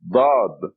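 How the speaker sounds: Dad said with a heavy emphatic D; thick, heavy D in Dad. Shown as a demonstration. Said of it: "This is a heavy D".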